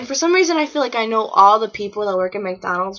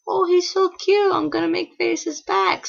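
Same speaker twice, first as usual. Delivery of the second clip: put-on high, drawn-out cooing over a cute child; 10 semitones higher than usual. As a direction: coo it slowly, pitched way up. Cooing at a cute little boy.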